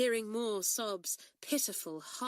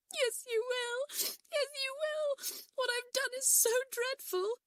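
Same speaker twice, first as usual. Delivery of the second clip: much higher, voice cracking with crying; sobbing. A narrator's reading. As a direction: wail it through sobs, much higher. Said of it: "sobbed Kathleen".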